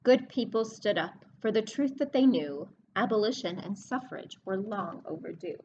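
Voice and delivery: storybook reading voice; reading a rhyming book to kids. Acted. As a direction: read the verse to the class, leaning on the rhyme. Teacher reads rhyming book aloud.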